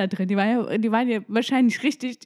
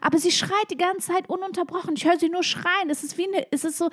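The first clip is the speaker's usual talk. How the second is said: hoch und drängend nachgespielt; aufgeregter Notruf; 8 semitones higher than usual. Retold or imitated. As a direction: den überforderten Anruf mit hoher Stimme nachspielen. Spielt aufgeregten Notruf nach. (aufgeregt nachgespielt)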